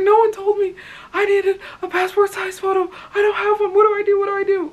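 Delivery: very high, loud mock-panicked wail; hammed-up panic; freaking out. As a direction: reenact a frantic meltdown, voice way up. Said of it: "I started freaking out".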